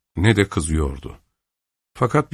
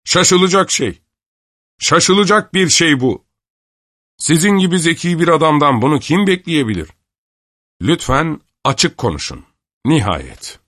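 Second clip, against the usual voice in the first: mock astonishment; 9 semitones higher, 4 dB louder than usual. Avdey mocking Kister's astonishment.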